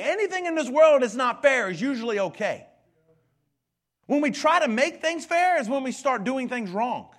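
loud, high preaching, driving every line; full-force preaching. Acted. Pastor drives the fairness point home, preaching at full volume. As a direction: preach it hard to the congregation.

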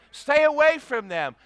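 loud, high, hammered warning; emphatic warning. Acted. Pastor warning young people off unbelievers, loud. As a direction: bark it at them.

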